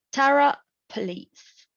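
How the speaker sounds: TERror stressed first, poLICE stressed second; contrasting word stress. Shown as a demonstration. Student demonstrates an exception to the rule, contrasting word stress. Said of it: "Police is accented on the second syllable".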